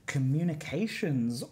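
usual speaking voice

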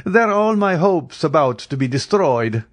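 high, loud mock lament; mocking and pitched well up; amused, laughing. A narrator's reading. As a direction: complain in playful dismay. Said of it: "said he, laughing".

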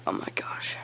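whispered, weary aside; whispered exasperation. Real, not acted. Gamer fed up with teammate, whispering to himself.

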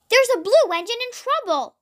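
high, urgent read-aloud call; mild puffed call. A narrator's reading. Flynn races down the track, calling in mild alarm. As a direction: raise the pitch as if racing to help. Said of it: "puffed Flynn as he raced".

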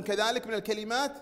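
ordinary delivery

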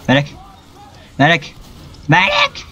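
rising calls for a medic; calling for medic. Real, not acted. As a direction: call out for the medic, pitch climbing.

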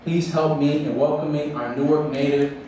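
ordinary talk, nothing marked

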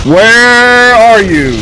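drawn-out hunting call; taunting call. Real, not acted. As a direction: call out to a hidden enemy, stretching the words. Calling out to hidden enemy.

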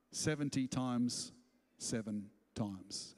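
low, slow, quiet reply; Jesus answering. Retold or imitated. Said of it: "And Jesus said".

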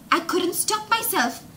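ordinary delivery

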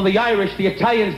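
ordinary talk

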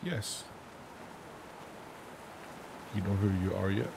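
usual speaking voice

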